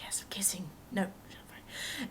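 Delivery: hushed inner voice; whispered thought. Retold or imitated. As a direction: whisper it to yourself.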